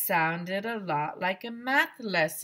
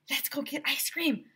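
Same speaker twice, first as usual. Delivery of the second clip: ordinary delivery, nothing marked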